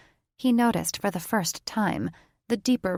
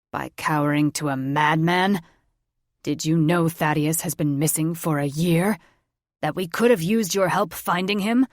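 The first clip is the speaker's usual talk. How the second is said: put-on louder, accusing young man; angry retort; 5 dB louder than usual. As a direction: hurl the accusation at them. Grandson angrily confronting grandparents.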